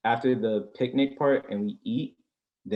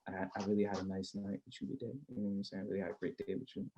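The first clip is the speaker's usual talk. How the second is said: much softer, intimate; soft goodnight; 2 semitones lower, 12 dB quieter, faster than usual. Retold or imitated. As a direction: murmur it at her door. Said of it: "walk her back to the crib".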